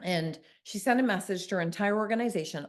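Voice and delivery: normal talking voice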